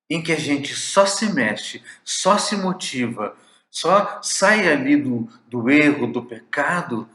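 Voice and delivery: usual speaking voice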